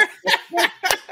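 falsetto giggle burst; squeaky laugh; high squealing laugh. Real, not acted. Laughing at janitor's disbelief.

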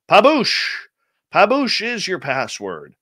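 PABOOSH with a long oo, ending in sh; password announced. Real, not acted. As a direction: sing out "Paboosh", oo as in boot. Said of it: "Wonder if it's Pabouche".

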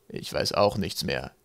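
low, slow and grumpy; surly. A narrator's reading. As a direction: answer sullenly, dragging the words. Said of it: "sagte er mürrisch".

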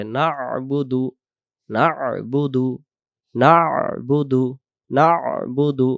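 "না'বুদু" slow, with a throaty ain after না; correct ain. Shown as a demonstration. Teacher modelling correct "না'বুদু", slow with throaty ain. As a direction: draw out না into the ain, then বুদু. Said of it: "practice করতে হবে".

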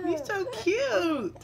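high, drawn-out adoring coo. Real, not acted. Mom to her newborn son, cooing.

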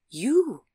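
"You" punched as a single emphatic answer. A narrator's reading. Cyclist answering what he was looking at, emphatic "You". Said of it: "he said emphatically".

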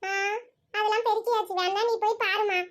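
ordinary delivery

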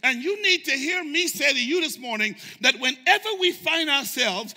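loud, high, hammering preacher cry; shouting sermon climax; full-force preaching. Acted. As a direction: preach it out at the top of the voice.

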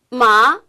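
마 rising, loud; second tone. Shown as a demonstration. Host repeats rising tone, loud. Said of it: "이성으로 하면".

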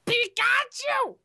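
high, slow, stretched-out mascot squeal; Pikachu. Shown as a demonstration. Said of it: "one more time. L-, let's hear it".

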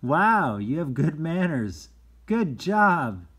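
loud, high, delighted "Wow"; enthusiastic praise. Shown as a demonstration. Reader praising the listening child, loud and delighted. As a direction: gush over the child's good manners.

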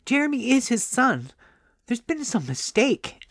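high-pitched, aggrieved storytelling voice; indignant woman. A narrator's reading. Indignant talk about the husband.

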